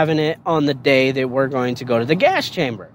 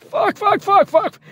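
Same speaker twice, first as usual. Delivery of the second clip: much higher, frantic string of curses; choking on gas, spitting curses in panic; panicked cussing; slower than usual. Retold or imitated. Recruit gasping in the gas chamber, high panicked cursing.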